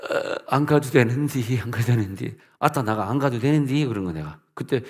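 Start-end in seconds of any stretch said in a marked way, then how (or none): 0.1-3.9 전라도 사투리로 느릿하게 버티기; Jeolla dialect. Retold or imitated. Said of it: "전라도 사투리가 나오다".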